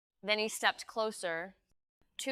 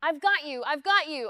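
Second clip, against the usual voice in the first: loud, raised-pitch reassurance; urgent protective cry; urgent shout; 6 semitones higher, 7 dB louder than usual. A narrator's reading. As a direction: shout to someone in panic that you have them. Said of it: "I shouted".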